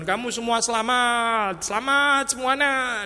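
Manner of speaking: high, drawn-out proclamation; slow, high mock herald; mock grand announcement. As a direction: announce it to everyone in a hammed-up singsong. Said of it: "datang terus mengumumkan".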